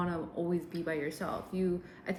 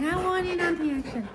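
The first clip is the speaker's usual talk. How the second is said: voicing the dog; 11 semitones higher, 5 dB louder than usual. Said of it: "She's like".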